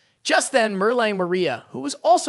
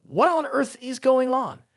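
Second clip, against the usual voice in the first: higher-pitched, amazed question; gasped amazement; 4 semitones higher than usual. A narrator's reading. Students can't believe their eyes, gasping in amazement. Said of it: "They gasped in amazement".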